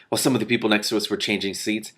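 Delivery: plain reading voice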